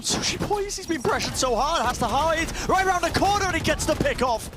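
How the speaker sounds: high, loud hype play-by-play; esports caster. Acted. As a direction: call every move with rising hype. Live play-by-play climax.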